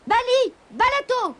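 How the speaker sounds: high cry of the magic words; shouted spell. A narrator's reading. The kitten recalls the spell-breaking words, high cry. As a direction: call out Bali, Balato high and sharp. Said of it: "en criant".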